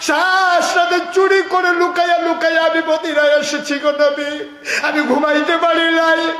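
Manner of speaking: high, loud chanted plea of anguish; lament prêché à pleine force. Acted. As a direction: cry out the grievance in a high sustained chant. Acts a companion's anguished lament.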